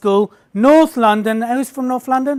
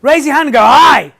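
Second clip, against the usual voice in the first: loud, punchy "Aye!" with a raised hand; mock Tony Robbins cheer; 2 semitones higher, 7 dB louder than usual. Shown as a demonstration. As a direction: hype the crowd like a motivational guru. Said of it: "I almost went".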